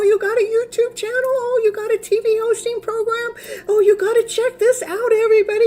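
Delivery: high-pitched, loud excited crowd chatter; gushing fans. Retold or imitated. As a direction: imitate party guests fussing over a celebrity. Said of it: "felt like I was the celebrity".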